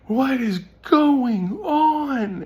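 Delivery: very high, loud, drawn-out mock wail; imitated shocked outburst. Retelling the girl as her arm starts moving, exaggerated shocked wail. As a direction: stretch every word into a loud, high cry.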